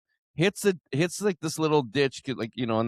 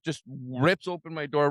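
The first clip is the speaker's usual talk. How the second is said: ordinary talk, nothing marked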